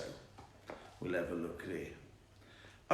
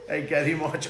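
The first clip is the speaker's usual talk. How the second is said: ordinary talk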